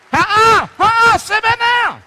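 high, loud teasing refusal; playful scolding. Acted. Preacher teases a congregant, loud and high. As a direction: shoo them back to their seat. Stops someone getting up.

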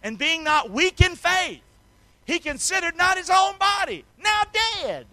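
high, loud preaching; ringing revival preacher; full-force preaching. Acted. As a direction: drive the scripture home to the church.

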